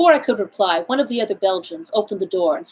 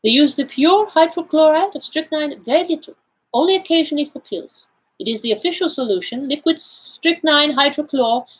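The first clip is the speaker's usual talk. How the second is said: put-on higher-pitched accented detective, explaining; Poirot, self-assured; 6 semitones higher than usual. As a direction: play the Belgian detective laying out his findings. Figure of Poirot in read story.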